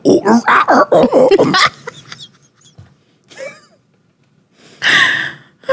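hammed-up holy-roller oohs; mock pious moaning. Mimics pious churchgoer at church doors.

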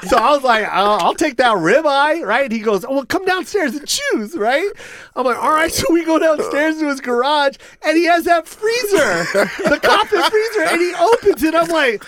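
ramp up with growing excitement; building to the punchline, thrilled; excited. Real, not acted. Story climax, excited high voice.

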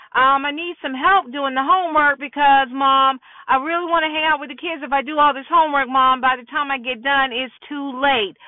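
put-on breathless-fast run of kid excuses; rapid-fire pleading. Son begging mom for help with homework, rapid pleading. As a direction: race through it, wheedling like a teenager.

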